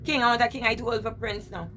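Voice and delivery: usual speaking voice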